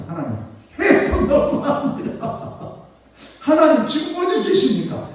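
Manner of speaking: rising to a loud pleading cry to God; anguished prayer. Retold or imitated. Preacher reliving his chemo prayer: anguished loud plea.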